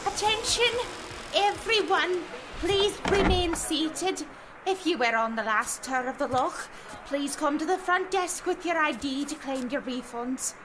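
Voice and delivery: pa page. Acted. Announcement in boathouse.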